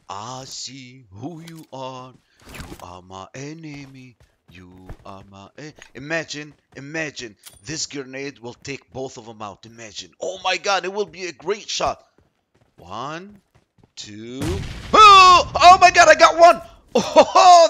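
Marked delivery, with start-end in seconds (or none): 0.1-5.7 slow, hushed, drawn-out taunt; toying with prey in a low, slow voice; menacing stalker taunt. Pretend. Sneaking up on enemy, sings slowly. 14.9-17.7 high, loud burst of triumph breaking into laughter; real elated yelling; ecstatic shout. Real, not acted. Lucky grenade kill.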